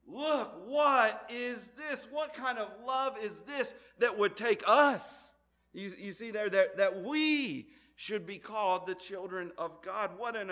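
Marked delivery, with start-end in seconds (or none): 0.1-5.3 high, loud, drawn-out marvelling; stunned by God's love; amazed exclaim. Retold or imitated. John marvelling at God's love, higher and louder amazement. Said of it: "that's what John is saying".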